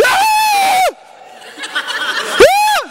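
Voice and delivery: high, loud hammed-up yell; silly shrieking battle yell breaking into a laugh; mock war cry. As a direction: scream at the wall like a fool. Mocking the soldiers' war cry.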